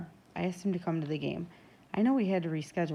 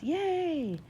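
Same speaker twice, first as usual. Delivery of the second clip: high, drawn-out cheer; gleeful team cheer; cheering shout; 11 semitones higher, 3 dB louder than usual. A narrator's reading. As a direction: let out a long, high yay. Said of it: "Benny shouts".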